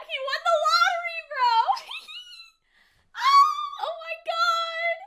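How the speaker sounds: high, loud squealing with a giggle; astonished excitement. Real, not acted. Viewer learns he won the lottery, ecstatic squeal. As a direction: burst out in thrilled disbelief. Shocked, thrilled at lottery win.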